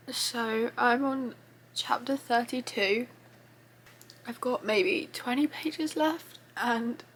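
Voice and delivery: softly tearful, barely keeping it together; holding back tears. Real, not acted. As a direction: speak through real tears you're trying to hold back. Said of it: "I've been trying to compose myself".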